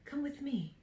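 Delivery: luring negative thought. Acted. Negative thought tempting the listener along, soft and luring. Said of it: "as those negative thought forms".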